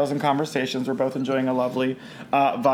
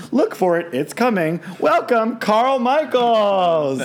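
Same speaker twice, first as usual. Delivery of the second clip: announcer intro; 5 semitones higher, 4 dB louder than usual. Acted. Host introducing the DJ guest to listeners, announcer intro. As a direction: read the guest's bio like an emcee, stretching the final welcome.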